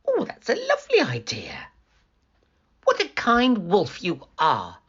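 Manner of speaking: put-on high, quick delighted girl; delighted little girl. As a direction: gush innocently. Girl's voice.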